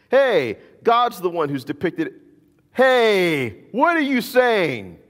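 high, loud outraged cry; outraged priest. Retold or imitated. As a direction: shout it like an offended high priest. High priest's outraged reaction acted.

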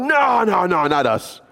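high, hammed-up protest; mock disciples protesting. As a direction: squeak out the denial.

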